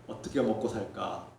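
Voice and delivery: low, soft worried thought; murmured retelling of his own worry; retold despair. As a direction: voice a helpless inner question, hushed and low. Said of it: "앞길이 막막합니다".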